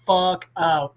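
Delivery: usual speaking voice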